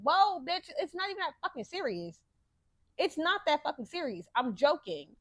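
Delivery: mock-outraged clapback; exasperated retort. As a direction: brush off the critics. Retorting to commenters.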